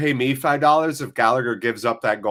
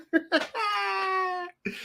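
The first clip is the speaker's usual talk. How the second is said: long, very high laugh trailing off; high squealing laugh; 7 dB quieter than usual. Real, not acted. Streamer cracking up at his chat, squealing laugh.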